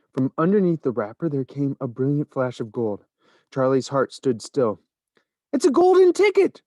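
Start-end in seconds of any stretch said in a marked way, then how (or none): 5.5-6.6 much higher, louder excited scream. A narrator's reading. Shopkeeper screaming at the golden ticket, much higher and louder. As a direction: shriek it out in sheer shock. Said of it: "screamed the shopkeeper".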